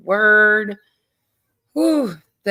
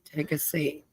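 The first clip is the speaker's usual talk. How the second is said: trailing off softly; 3 semitones lower, 11 dB quieter than usual. Real, not acted. Repeated invitation fading off.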